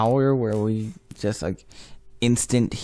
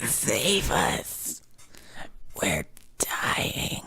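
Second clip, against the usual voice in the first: soft, breathless plea; mock dying whisper; 5 semitones lower, 9 dB quieter, much slower than usual. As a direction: whisper like you're squished under a rock. Joking whisper.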